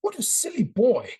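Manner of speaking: high, prim English mother scolding; English accent, a mother tutting at her son; lightly posh English mother tut. Retold or imitated. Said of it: "in this English voice".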